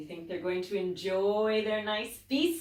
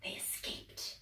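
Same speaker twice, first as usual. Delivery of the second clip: whisper; 15 dB quieter than usual. Acted. Hushed aside after the chase.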